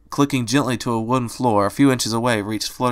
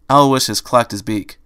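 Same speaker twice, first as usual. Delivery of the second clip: plain reading voice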